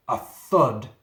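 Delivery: loud, heavy thud; booming thud. Acted. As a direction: slam the word like a shoulder on the door. Monster pounding the door.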